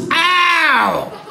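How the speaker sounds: high, loud comic "ow!"; little fish's yelp. Pretend. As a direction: shriek it like a zapped little fish. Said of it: "the little fish goes".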